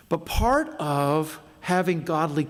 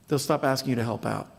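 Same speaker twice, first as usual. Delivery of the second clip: normal talking voice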